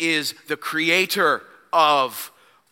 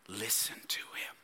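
hushed whisper to the congregation; 8 semitones lower, 18 dB quieter than usual. Acted. Preacher whispering to listeners, hushed. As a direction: breathe it out, no voice.

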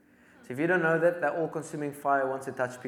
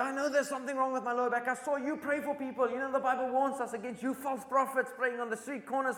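put-on grumpy old man; 10 semitones higher than usual. Angry old man rebukes preacher.

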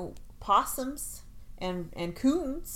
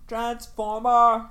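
announcer voice; 2 semitones higher, 10 dB louder, slower than usual. Pretend. Announces cartoon name.

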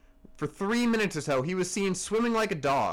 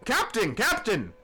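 high calls of Captain, pitched way up; yelled; 6 semitones higher than usual. A narrator's reading. Bumpkin calling out to the officer, high yell. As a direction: shout for the captain in alarm. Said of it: "yelled the bumpkin".

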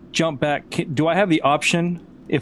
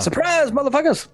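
high, gleeful taunt; spring it on everyone in a high voice; hammed-up surprise announcement; mock surprise shout; 11 semitones higher than usual. Player echoes the joke surprise.

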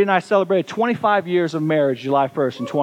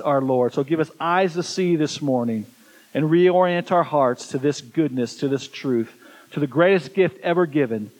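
prayer; 4 semitones lower than usual. Acted. Pastor leads congregation in prayer.

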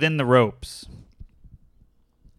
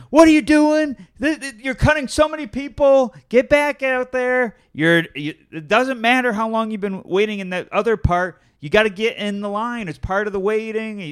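high, raised-voice scolding tirade; furious outburst, imitated; 10 semitones higher, 4 dB louder than usual. Imitating the father yelling at the line cutter, angry tirade. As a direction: imitate a man exploding at a queue-jumper. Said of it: "he turns to eleven quick".

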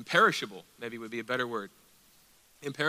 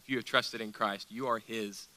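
ordinary talk

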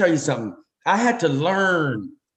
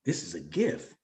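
soft, low, awed whisper of discovery; hushed, slow dawning wonder; quiet realization; 7 semitones lower, 11 dB quieter than usual. Retold or imitated. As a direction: breathe it out as you realize. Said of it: "And I said".

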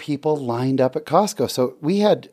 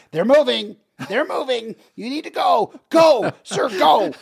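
much higher, frantic imitated urging; panicked lady; 12 semitones higher than usual. Lady in Costco line urges man to move, frantic high-pitched. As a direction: mimic a panicking shopper shooing him on. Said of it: "And she's freaking out".

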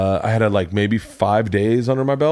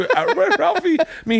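laughing. Real, not acted. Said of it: "laugh heard".

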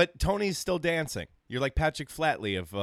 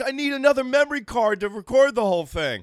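excited; 10 semitones higher, 7 dB louder than usual. Pretend. Imagining himself excited.

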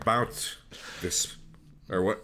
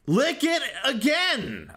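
excited jingle shout; 13 semitones higher, slower than usual. Retold or imitated.

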